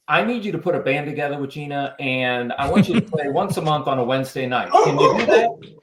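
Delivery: hint of someone else's voice, lifting to a loud, high finish; Karis. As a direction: put on a persuasive friend's voice, lightly. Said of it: "channeled your inner Karis there".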